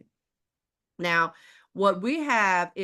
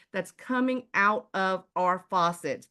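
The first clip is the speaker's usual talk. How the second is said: ordinary talk, nothing marked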